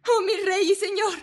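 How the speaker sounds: high, desperate mother's cry; anguished exclamation. A narrator's reading. True mother begs the king for her child, anguished. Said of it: "exclamó la verdadera madre".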